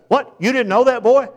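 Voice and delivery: high, loud mocking bark of a bullying father; intimidating angry dad. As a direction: belittle the son, hammed-up and loud. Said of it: "the father was always angry and intimidating".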